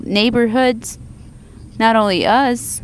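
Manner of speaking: usual speaking voice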